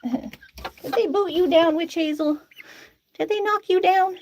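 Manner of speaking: high, drawn-out pet talk; cooing to pet. Real, not acted. Owner to a chicken that got knocked down, cooing concern.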